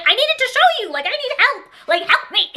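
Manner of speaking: high, whiny plea for help; begging her mom in a high whine; mock pleading. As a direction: beg like a desperate little kid.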